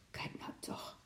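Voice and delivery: whispered in amazement; hushed astonished whisper; awed whisper. A narrator's reading. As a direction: breathe it so as not to wake the lynx. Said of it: "fluisterde oom Nijltje".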